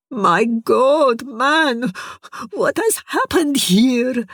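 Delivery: little curator horrified at the ruin; shocked Masri. Acted. Masri shocked at his friend's state.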